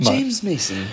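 high, scoffing repeat of the name; incredulous echo. Retold or imitated. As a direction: say the name like it's absurd. Echoing the casting with scorn.

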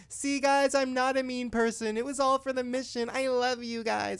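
high, drawn-out sugary plea; put-on high girly voice, insincere innocence; Farrah. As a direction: imitate someone sweetly excusing themselves. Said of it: "And then Farrah goes".

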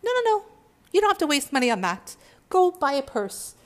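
wheedling ego talking you out of healing; the ego's voice. Retold or imitated. The ego coaxing the listener to skip healing, high and wheedling. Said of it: "the ego constantly is in the background saying".

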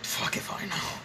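whispered shrug of a reply. Real, not acted. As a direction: breathe it out as a whisper. Repeats earlier retort.

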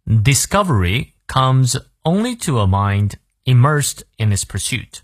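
deliberate, measured teacher's read-through; slow model reading. Shown as a demonstration. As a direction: read the whole sentence slowly and clearly. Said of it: "从头到尾，我们来过两遍".

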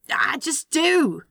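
high, drawn-out insistence; defensive outburst. Acted. Demon with no argument left, defensive. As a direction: insist stubbornly, stretching the words.

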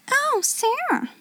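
high-pitched put-on friendly greeting; exclaimed, feigned pleasantness. A narrator's reading. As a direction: exclaim sweetly in a high voice with a forced smile. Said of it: "endeavoring to produce a suitable smile".

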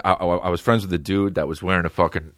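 ordinary delivery

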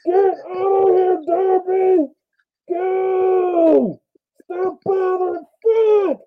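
high, loud, drawn-out mock scream; comically desperate high-pitched yelling; screaming from inside. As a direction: holler hysterically at a pest outside your house. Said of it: "that shit be screaming from the inside".

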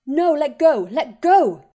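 desperate high scream; frantic shrieking struggle. Acted. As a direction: scream it, fighting to break free. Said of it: "Harry was screaming".

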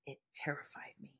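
breathless whisper of remembered fear. Retold or imitated. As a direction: whisper it as if still scared. Repeat of the line, whispered.

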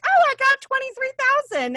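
high, bright squeal of surprise at a small number; mock excited self. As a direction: squeak it out like a giddy younger self. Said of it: "when I was like".

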